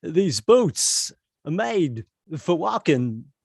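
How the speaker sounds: very slow, halting Walken delivery; talk-sing it like Walken; Christopher Walken impression. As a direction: Walken crooning [name]. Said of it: "Christopher Walken singing his favorite [name] song".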